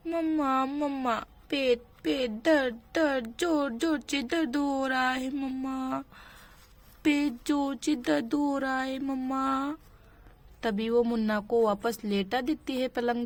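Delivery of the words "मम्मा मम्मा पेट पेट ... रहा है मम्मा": put-on babyish, halting whimper with repeated falling words; crying toddler. Toddler tells mother of bad tummy ache, pretend whimper. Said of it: "वो बहुत जोर से रो रहा है".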